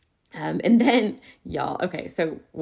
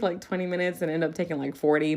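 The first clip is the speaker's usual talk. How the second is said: usual speaking voice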